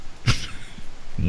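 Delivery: breathless unvoiced gremlin snicker; a soft gleeful gremlin giggle; squeaky gleeful gremlin giggle. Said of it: "Gremlin voice?".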